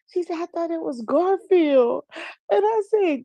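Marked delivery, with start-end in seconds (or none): 0.4-2.0 high, slow imitation of an innocent girl; innocent niece. Niece explaining her mix-up to her aunt, imitated innocence. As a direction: play the niece owning up guilelessly. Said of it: "she was so innocent with it".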